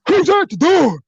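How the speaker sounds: squeaky high call from behind the door. Retold or imitated. Voice behind the door calling out high.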